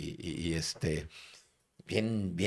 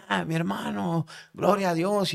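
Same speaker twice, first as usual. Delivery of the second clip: joyful exclamation; 8 semitones higher than usual. Retold or imitated. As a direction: imitate a delighted brother praising God. Acted joyful reply between cells.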